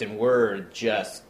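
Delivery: ordinary delivery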